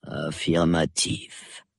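low, slow, soft drowsy colonel; sleepy mumble. A narrator's reading. Half-asleep colonel humours his wife, mumbling. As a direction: mumble it slowly, barely awake. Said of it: "marmonna le colonel".

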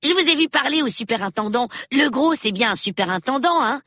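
put-on high, quick child's voice; eager young boy. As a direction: pitch it up like an inquisitive boy. Reader voices young Peter. (voix aiguë de gamin curieux)

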